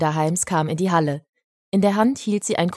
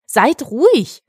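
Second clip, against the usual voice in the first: put-on higher, louder boy's command; demanding quiet; 7 semitones higher, 6 dB louder, slower than usual. A narrator's reading. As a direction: demand silence from the other children. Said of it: "verlangte Henrik".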